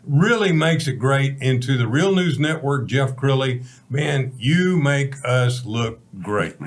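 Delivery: ordinary talk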